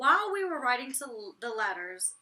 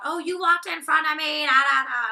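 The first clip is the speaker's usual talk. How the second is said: loud, higher-pitched bickering imitation; mock arguing; 6 semitones higher, 13 dB louder than usual. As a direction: imitate a petty squabble at full volume. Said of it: "[name] and [name] are arguing now".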